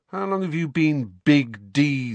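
high, mocking taunt. A narrator's reading. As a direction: needle your cousin. Harry taunting Dudley.

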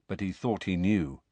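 usual speaking voice

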